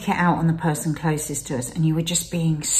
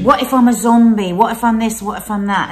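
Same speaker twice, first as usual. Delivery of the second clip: anxious quoting; 4 semitones higher, 6 dB louder than usual. Retold or imitated. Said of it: "Mark kept saying".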